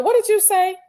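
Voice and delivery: high, startled question; what did he just say; surprised. Real, not acted. Reacting to a strange call.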